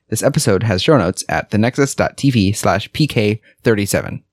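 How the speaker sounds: upbeat announcer read; podcast intro read. Acted. Formal show intro read.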